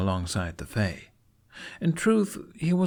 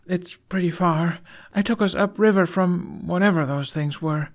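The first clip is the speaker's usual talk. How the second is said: put-on higher, shy boyish voice; young boy's voice; 10 semitones higher, 4 dB louder than usual. A narrator's reading. Boy thanking his friend for staying, shy and higher.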